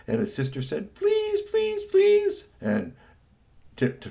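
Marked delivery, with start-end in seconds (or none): 0.9-2.4 put-on very high little-girl pleading; begging. Sister begs mother for the manual, pleading. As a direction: squeak it, growing louder.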